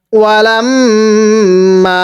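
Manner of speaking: ওয়ালাম with hummed ম, then a drawn-out মা; ghunnah and stretch. Shown as a demonstration. Teacher joins the syllables for students, ghunnah and stretch. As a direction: hum the ম, then lengthen মা one beat. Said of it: "এক আলিফ টান দিব".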